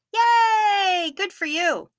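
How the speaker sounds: high excited cheer; mock cheer. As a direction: cheer like for a toddler. Mock celebration.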